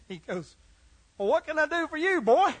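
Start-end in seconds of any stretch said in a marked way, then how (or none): 1.2-2.5 high, quick coaxing to a pet; talking to a dog. Acted. Butcher greeting a dog in his shop, talking to a pet.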